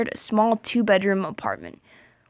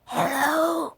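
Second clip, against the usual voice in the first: a higher, thinner creepy hello; higher raspy try; 6 semitones higher, 3 dB quieter than usual. Shown as a demonstration. Trying the stranger's voice again, higher. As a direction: pitch it up as the strange voice. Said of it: "Like that kinda".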